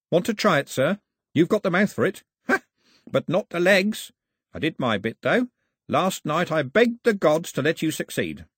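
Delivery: put-on high-pitched, goading old man; teasing old priest. Old man teases the boy.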